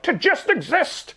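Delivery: high, slow, put-on monster voice; mock wistful monster. Monster telling Kate what he wants, mock wistful and slow. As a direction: sigh it out like a lost creature. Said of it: "the Frankenstein's monster goes like".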